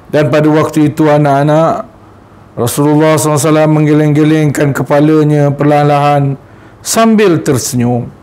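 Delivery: usual speaking voice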